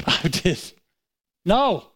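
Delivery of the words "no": raised, emphatic refusal; defiant retort; stubborn refusal. Retold or imitated. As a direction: dig in with a firm no. Acts out his stubborn refusal.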